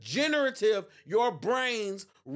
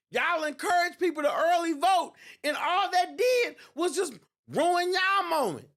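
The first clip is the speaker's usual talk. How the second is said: heated rant; 9 semitones higher, 4 dB louder than usual. Real, not acted. Ranting at opponents.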